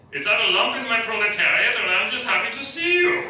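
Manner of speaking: a lightly put-on German-ish accent; mock Karl Marx accent. Comic doing a mock Karl Marx accent for the crowd. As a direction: ham up a vague Upper Westphalian accent. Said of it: "I'm gonna do some Karl Marx impersonations".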